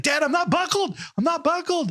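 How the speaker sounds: put-on high child's voice repeating worriedly; anxious child. Son nervously reminding dad, high child voice. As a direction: fret like a scared kid.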